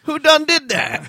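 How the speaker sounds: high, slow yokel voice; hammy yokel voice. Pretend. Mock bumpkin outcry.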